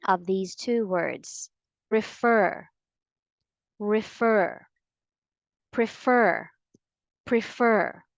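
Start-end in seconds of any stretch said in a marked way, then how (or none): 1.9-4.6 "Refer" said re-FER, the stress on the second syllable. Shown as a demonstration. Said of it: "put the stress always on the second syllable". 5.7-8.0 "prefer" said pre-FER, the stress on the second syllable. Shown as a demonstration. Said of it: "put the stress always on the second syllable".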